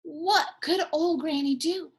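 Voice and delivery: ordinary delivery, nothing marked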